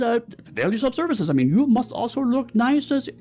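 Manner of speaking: very high, wordy fake Korat accent; Mister Meow. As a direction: ramble ingratiatingly in a high put-on Korat accent. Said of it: "fake Korat accent you've ever heard".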